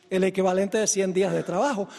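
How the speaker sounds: ordinary talk, nothing marked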